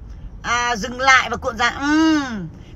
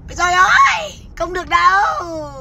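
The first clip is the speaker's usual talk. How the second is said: mock dismay; 6 semitones higher than usual. Streamer mocking a woman's plan to rescue prisoners, mock dismay. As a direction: wail "oh no" in exaggerated dismay, voice shooting up.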